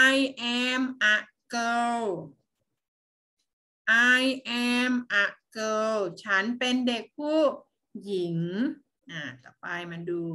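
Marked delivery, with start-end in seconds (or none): 0.1-6.0 put-on very slow, drawn out for kids to repeat; read-along model. Shown as a demonstration. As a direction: model each word slowly for the class. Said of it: "อ่านตามนะคะ". (อ่านช้ามากให้เด็กอ่านตาม)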